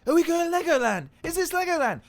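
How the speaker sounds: put-on very high, loud kid squeal of words; imitated excited child. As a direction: mimic a small boy bursting with excitement. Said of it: "You're so excited".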